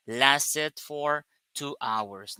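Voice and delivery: "lasted for two hours" drawn out slowly, weight on "lasted"; slow emphasis on duration. Shown as a demonstration. Teacher stressing to students how long it lasted, slowly. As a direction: stretch "lasted" out to show how long it went on. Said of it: "I want to emphasize".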